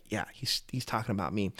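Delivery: soft whisper to herself; a quiet whispered aside; whispered realization. Retold or imitated. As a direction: breathe it as quiet recognition. Woman realizes in crowd.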